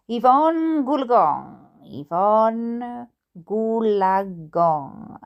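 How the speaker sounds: very slow, drawn-out chant; mantra. Acted. Dad driving in a reverie, chanting a mantra. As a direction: stretch each syllable as if entranced. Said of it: "as if it were a travelling mantra".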